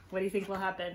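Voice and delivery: ordinary delivery, nothing marked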